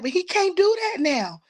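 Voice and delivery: high-pitched protest to God; anguished protest. Retold or imitated. Retelling her protest to God.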